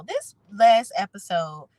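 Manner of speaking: normal talking voice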